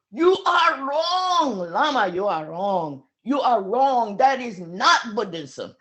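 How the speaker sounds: loud, slow furious shouting at the teacher; angry shouting. Retold or imitated. Angry student shouting at his lama. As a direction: yell it, accusing him of being wrong. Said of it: "was shouting at Lama Yeshe".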